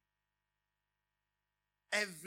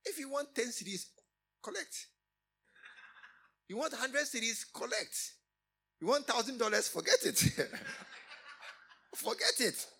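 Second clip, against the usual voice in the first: loud, higher teasing refusal; preaching at full force; 8 semitones higher, 7 dB louder than usual. Acted. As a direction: rib the daughter with a grin. Preacher voices the father, laughing.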